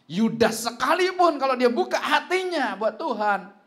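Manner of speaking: loud, slow preacher's cry; preaching shout. Real, not acted. Preacher to congregation about Judas, loud and slow. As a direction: hammer the point home, full voice. Preacher driving home key point.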